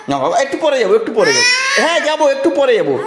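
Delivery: high-pitched, gently firm with a laugh; high soothing refusal. Real, not acted. Refusing toddler's demand.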